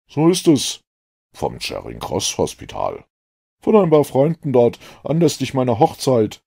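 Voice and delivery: put-on a keen, relieved young man; a lightly voiced eager doctor. A narrator's reading.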